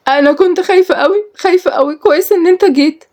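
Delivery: high, shaky-sounding relief; cling to him, still scared; frightened relief. Acted. Rescued Nour still frightened.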